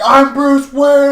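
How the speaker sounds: high, loud, slow triumphant shout; gleeful boast. Retold or imitated. Drunk Clark proudly announcing his fake identity, loud and high. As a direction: belt it out like a proud party boy.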